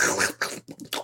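imitated squelching with the mouth; wet suction noise. Podcaster imitating the movie's sucking sounds, wet squelchy noise. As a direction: make wordless wet slurping clicks. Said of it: "a lot of suction".

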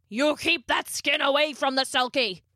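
put-on high, menacing old man roar; angry roar. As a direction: roar it as a threat. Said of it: "Mister Carver roared".

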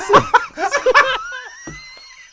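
high, loud burst of laughter; long squealing cackle; laughing. Real, not acted. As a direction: lose it completely. Laughing hard at own tease.

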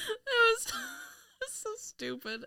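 helpless laughter through the words; high, laughter-broken speech; real laughing. Real, not acted. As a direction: squeeze the words out while cracking up. Cracking up at the silly reveal.

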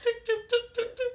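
very high tick tick jingle sound. Retold or imitated. Imitating the shark's jingle under water, high ticking. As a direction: mimic a tiny jingling bell.

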